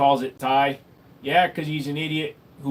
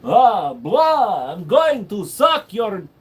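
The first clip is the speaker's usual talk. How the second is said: mock vampire; 4 semitones higher, 6 dB louder, much slower than usual. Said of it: "vampire blah".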